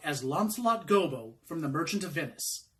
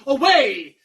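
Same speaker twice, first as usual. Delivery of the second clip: loud, raised cry of command; urging fiend; 8 semitones higher, 13 dB louder than usual. Acted. Fiend orders servant to flee, loud urging. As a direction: send him off with a theatrical shout. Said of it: "says the fiend".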